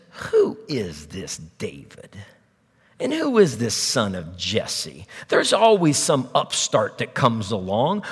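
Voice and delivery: slow, low, sneering rich man; surly Nabal. Acted. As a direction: drag each word out with lordly contempt, pitched low. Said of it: "Nabal was a mean and surly spirited man".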